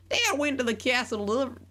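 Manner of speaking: put-on very high girl voice with a country accent; country farm girl voice, high and sweet. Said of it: "a country accent".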